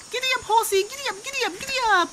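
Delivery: high sing-song giddy up; playful giddy up. Pretend. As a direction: bounce it like riding a pony. Mother plays horse riding.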